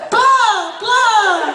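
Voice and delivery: high, loud mock lip flaps; mock mouth flaps. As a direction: mimic the animation's two flaps. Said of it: "It's two flaps".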